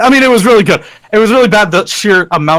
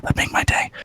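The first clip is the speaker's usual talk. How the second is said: whisper; 11 dB quieter than usual. Real, not acted. Shyly shilling for own channel.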